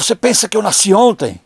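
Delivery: imitar bronca, mais alto e agudo; mãe indignada. Retold or imitated. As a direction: retrucar como mãe desconfiada. Mãe brava não acredita no filho. (indignação materna encenada)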